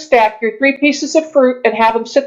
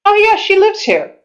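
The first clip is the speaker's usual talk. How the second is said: innkeeper voice; 9 semitones higher than usual. Acted. GM innkeeper and player exchange.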